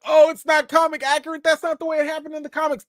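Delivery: fast, whiny, high comic-book purist; mock the fussy fan; lightly voiced nerdy critic; nitpicky critic. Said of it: "that critic that's like".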